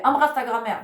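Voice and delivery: insist, raising the voice; insistent parent order. Retold or imitated. Parent insists a third time.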